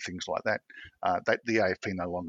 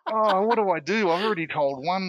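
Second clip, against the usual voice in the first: panicked inner thought; 9 semitones higher, 4 dB louder than usual. Retold or imitated. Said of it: "I thought".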